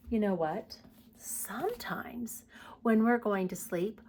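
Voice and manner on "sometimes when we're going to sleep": hushed sleepy-time whisper. Real, not acted. Talking to kids about bedtime, whispered.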